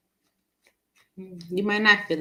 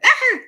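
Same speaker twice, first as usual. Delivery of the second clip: short high mock shriek; loud, playful startled yelp; imitated scream; 9 dB louder than usual. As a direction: act out screaming at nothing. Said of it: "how many times I screamed".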